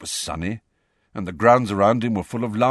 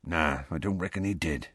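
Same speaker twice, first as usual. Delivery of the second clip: low and much softer; quietly; 4 semitones lower, 7 dB quieter than usual. A narrator's reading. Hagrid giving in to Harry with head bowed, quiet. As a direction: say it quietly, conceding. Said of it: "he said quietly".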